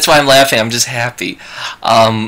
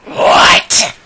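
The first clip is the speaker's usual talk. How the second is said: loud, voiceless snarl; 5 dB louder than usual. Acted. Stranger turning on the approaching adventurer, snarling. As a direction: snarl it out with a rough rush of breath. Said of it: "he turns around snarling at you".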